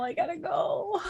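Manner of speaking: mock sobbing child voice; whiny kid. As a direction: fake tearful kid leaving.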